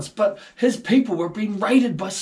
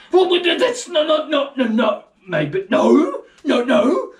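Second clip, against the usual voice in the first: higher, louder fumbling no's; flustered backpedal; 6 semitones higher, 4 dB louder, slower than usual. Retold or imitated. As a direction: caught out by the dad's logic. Villagers flustered by the argument.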